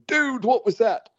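high mock ghost-hunter gasp on dude; hammed-up TV ghost hunter, pitched high. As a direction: send up the TV ghost hunters' fake alarm. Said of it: "when they're all sitting there going".

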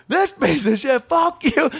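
very high, exploding insult; hammed-up outraged yell; mock fury. Toll guy curses the passenger to his face, high mock fury.

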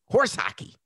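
sharp high scoff; high mocking scoff; scoffing dismissal. Real, not acted. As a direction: wave it off with disbelief. Scoffing at the bid's excuse.

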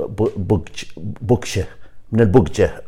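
ordinary talk, nothing marked

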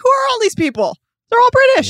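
high-pitched mock disbelief; astonished outburst. Acts out astonishment at British cast.

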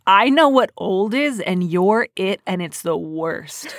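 slow, drawn-out youthful disdain; young know-it-all. Retold or imitated. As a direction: imitate a twenty-year-old's verdict, slowly. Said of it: "late teen, early twenties sense of".

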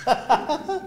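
high-pitched, breaking laugh; burst of laughter. Real, not acted. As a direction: crack up in a high giggle. Laughing at friend's horror line.

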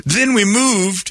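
high folksy Southern voice; sweet-talking Southern lawman; drawling Southern sheriff. Acted. Voicing sheriff.

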